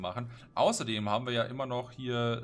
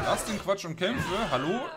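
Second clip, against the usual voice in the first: laughing taunt; 8 semitones higher, 8 dB louder than usual. Real, not acted. Taunting enemies in a fight.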